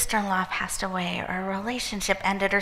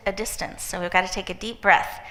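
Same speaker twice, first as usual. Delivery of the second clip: ordinary talk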